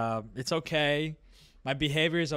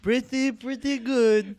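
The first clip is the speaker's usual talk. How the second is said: put-on high, very drawn-out Romanian-accented praise; slow put-on Romanian approval; 8 semitones higher, 4 dB louder, much slower than usual. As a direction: savour each word in a mock Romanian accent. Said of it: "I'm the Romanian guy".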